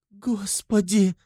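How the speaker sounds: high, drawn-out cry of fright; slow, high exclamation of dread. A narrator's reading. Terrified mother as her son says farewell, crying out. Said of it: "вскрикнула Пульхерия Александровна".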